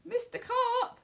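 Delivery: very high calling out; worried boy shouting a name; yelled. A narrator's reading. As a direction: call out across the pool, high. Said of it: "I yelled".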